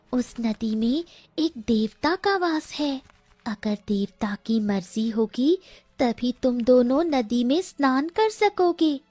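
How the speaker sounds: high, bird-like warning voice; a magic bird menacing the lost pair; high myna voice foretelling doom; talking myna bird. A narrator's reading. Myna bird speaks again.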